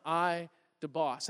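put-on quiet, slow little-boy voice; soft toddler imitation. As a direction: say it softly like a toddler. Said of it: "I hear Judah say".

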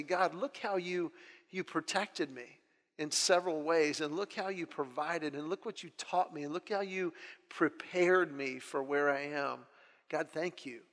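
soft, hushed thanks; grateful prayer. Retold or imitated. Man reflecting to God on being protected and prepared, quietly grateful. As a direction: speak quietly and sincerely, as in private prayer.

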